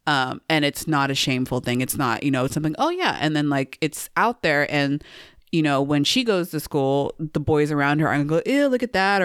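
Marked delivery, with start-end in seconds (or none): 2.8-3.2 high casual quote. Retold or imitated. Imitating a casual reaction. 8.5-9.2 mock boys teasing.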